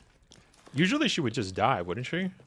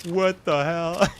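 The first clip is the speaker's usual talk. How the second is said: higher, drawn-out mock disbelief; mock exasperation; 5 semitones higher, 3 dB louder, slower than usual. Streamer retelling their own crash-out, mock exasperated. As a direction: stretch it out in mock outrage. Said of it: "It's just being like".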